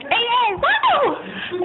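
high, whooping fake excitement; mock cheering. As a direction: whoop it up like a party that never happened. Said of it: "I wasn't like".

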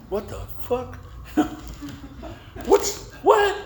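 high-pitched mock disbelief; retold astonishment. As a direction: blurt it out as if baffled. Retelling shocked reaction at birth.

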